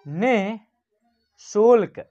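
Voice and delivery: निःशुल्क stretched out slowly, a breath after नि; very slow clear reading. Shown as a demonstration. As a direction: read निःशुल्क very slowly, syllable by syllable. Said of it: "इसको हम लोग पढ़ेंगे निःशुल्क". (निःशुल्क को बहुत धीरे, नि के बाद ह के साथ)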